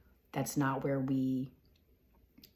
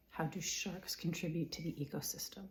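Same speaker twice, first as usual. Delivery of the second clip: whispered question, no voice; 2 semitones higher, 7 dB quieter, faster than usual. Shown as a demonstration. Repeating the question to herself in a whisper. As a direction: breathe the question out silently. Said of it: "repeated question".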